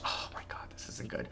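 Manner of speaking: breathless whisper of dread. Real, not acted. Gamer watching the last seconds tick, tense whisper.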